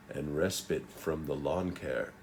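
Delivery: low, soft throwaway remark; dry aside under the performance; muttered aside. Real, not acted. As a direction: drop out of the speech for a quiet joke. Muttered joke aside mid-speech.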